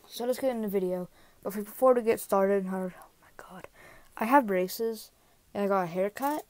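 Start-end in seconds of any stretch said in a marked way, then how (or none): 2.7-3.7 whispered aside. Real, not acted. Aside about braces and haircut.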